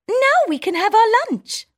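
high squeaky mouse, relieved; all clear in a tiny voice; town mouse voice. Acted. Town mouse telling her friend the danger's gone, high squeak.

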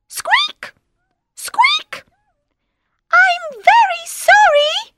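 very high squeaky mouse pleading; tiny mouse voice. Acted. Mouse begs the lion not to eat him, squeaky. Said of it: "says the mouse".

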